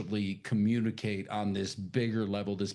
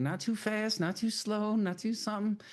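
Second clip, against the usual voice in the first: mock advice voice; 10 semitones higher than usual.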